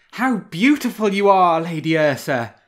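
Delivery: loud, high admiring cry; cried out. A narrator's reading. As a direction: cry out in delight at her beauty. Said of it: "he cried".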